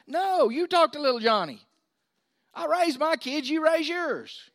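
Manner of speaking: stern no-nonsense reply; harsh. Real, not acted. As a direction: put it bluntly to the parents. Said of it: "Was that too harsh".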